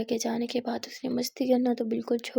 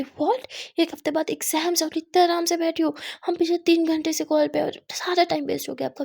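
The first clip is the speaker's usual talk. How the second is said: higher and louder, alarmed disbelief; shocked scolding; 5 semitones higher, 6 dB louder than usual. Acted. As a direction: scold her in sudden alarm. Aadya shocked exams are near. (हैरान होकर ऊँची, तेज़ आवाज़ में डाँटना)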